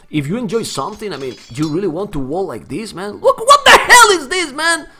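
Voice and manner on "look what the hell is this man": high, loud exasperated ranting; angry rant. Real, not acted. Streamer ranting angrily at a walling opponent. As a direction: rage at the opponent's endless walls. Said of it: "I'm angry, man. I'm angry".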